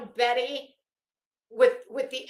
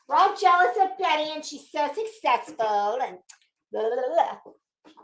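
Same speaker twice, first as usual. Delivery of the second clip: higher and louder, a mocking singsong; sarcastic mockery; 5 semitones higher, 5 dB louder than usual. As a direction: mimic her line with scorn. Mocking the claim everyone's jealous.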